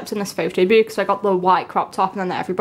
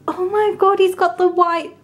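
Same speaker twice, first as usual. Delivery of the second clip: much higher, bright gush; pitched-up squeal of joy; excited squeal; 10 semitones higher than usual. Real, not acted. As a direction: shriek with delight.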